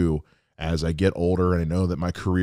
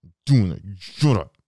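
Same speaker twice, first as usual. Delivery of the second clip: mom putting her foot down, louder; firm mom; 5 dB louder than usual. Retold or imitated. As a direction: insist like a stubborn mother. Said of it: "she puts her foot down".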